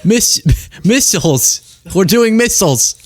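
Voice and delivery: half-laughing Trump line about missiles; mock Trump explaining the missiles; Trump impression. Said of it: "then he goes".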